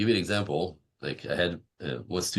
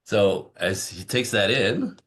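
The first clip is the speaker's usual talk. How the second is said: ordinary talk, nothing marked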